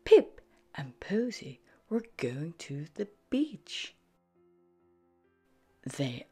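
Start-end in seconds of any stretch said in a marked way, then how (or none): none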